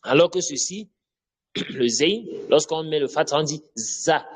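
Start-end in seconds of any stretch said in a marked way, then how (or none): 3.7-4.3 "za" with a buzzing French Z; French Z sound. Shown as a demonstration. Teacher showing zayn with fatha, French Z in "za". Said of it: "comme le Z en français".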